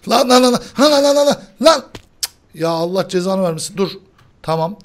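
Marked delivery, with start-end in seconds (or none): 0.1-1.8 high, loud repeated lan; panicked rapid yell. Real, not acted. Host yelling in panic while his friend teases the shouting. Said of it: "İşiniz gücünüz bağırmak abi".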